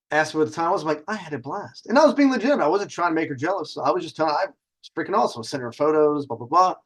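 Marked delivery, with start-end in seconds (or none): none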